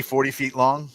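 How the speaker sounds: ordinary talk, nothing marked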